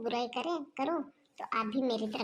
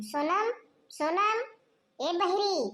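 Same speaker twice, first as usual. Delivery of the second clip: drawn-out high call across the house, louder; 7 semitones higher, 5 dB louder, slower than usual. Acted. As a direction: call her name slowly from another room, then scold. Mother-in-law calls daughter-in-law loudly.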